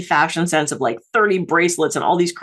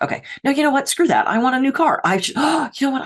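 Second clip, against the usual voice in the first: sudden resolve; 8 semitones higher than usual. Acted. Acted inner dialogue, sudden craving.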